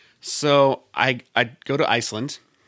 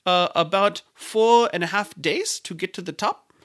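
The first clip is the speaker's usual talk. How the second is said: high, deliberate mock Icelandic voice; slow-starting, lilting Icelandic imitation; Icelandic guide's accent; 6 semitones higher than usual. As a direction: draw out the answer like an Icelandic guide. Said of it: "He goes".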